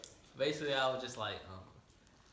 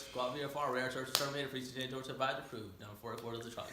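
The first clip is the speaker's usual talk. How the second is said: quick, run-together pilot patter; rapid radio readback; faster than usual. Retold or imitated. Pilot reading back to the controller, rapid patter. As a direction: rattle off the readback in one breath. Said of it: "I don't know what the hell".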